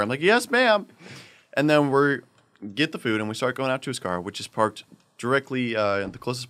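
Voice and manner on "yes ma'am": high-pitched, snappy compliance; acted-out dutiful employee; prompt obedience. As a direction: pipe up obediently. Obeying manager's order.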